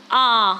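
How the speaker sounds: "आ" as a long, wide-open "aah"; open vowel demo. Shown as a demonstration. Teacher has students try the vowel, "आ" fully open. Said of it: "आपका मुख पूरा खुला रह जाए".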